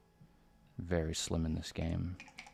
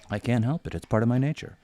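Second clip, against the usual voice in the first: loud; 5 semitones higher, 11 dB louder, faster than usual. Real, not acted. Joking about being brash.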